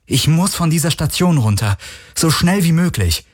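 high, urgent begging; desperate appeal; pleading. A narrator's reading. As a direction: beg to get away, pitch climbing. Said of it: "Garland sah sie flehend an".

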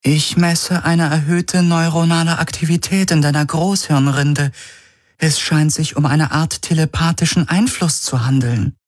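höhere, sachliche Computerstimme; Stimme der Helix. A narrator's reading. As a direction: wie eine besorgte KI berichten. Bordcomputer Helix spricht. (Helix meldet Galen die Messwerte)

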